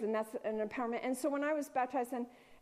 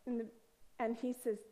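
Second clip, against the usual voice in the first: ordinary talk, nothing marked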